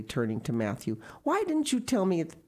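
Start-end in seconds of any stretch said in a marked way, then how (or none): 1.2-2.4 put-on reproachful child. A narrator's reading. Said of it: "she added reproachfully".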